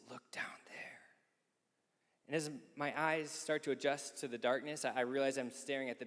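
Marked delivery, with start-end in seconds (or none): 0.1-0.8 whispered, voiceless and hushed. Retold or imitated. Old neighbor whispering to Nick at Shaq's bedside, hushed whisper. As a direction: lean in and whisper it in the dark.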